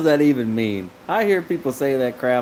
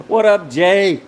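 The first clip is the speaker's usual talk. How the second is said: hearty loud hello, higher and louder; loud greeting; 7 semitones higher, 7 dB louder, slower than usual. Real, not acted. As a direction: call across the room to Jay. Greeting friend loudly.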